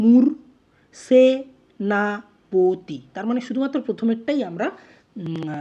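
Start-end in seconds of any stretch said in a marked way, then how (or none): 0.1-3.0 মূর, then সেনাপতি stretched slowly as se, na, po, ti; syllable by syllable. Shown as a demonstration. Teacher breaking the word into syllables, syllable by syllable. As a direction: pull মূর সেনাপতি apart with pauses. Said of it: "প্রথমে আমরা পেলাম".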